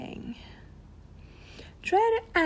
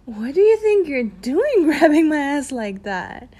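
playful louder scold, laughing through it; mock indignant scolding; 4 semitones higher, 7 dB louder than usual. Teasing the listener for grabbing, mock scold. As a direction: tease him for grabbing.

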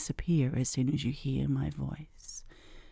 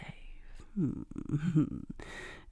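whispered giggle; 4 dB quieter than usual. Acted. Teasing hypnosis roleplay.

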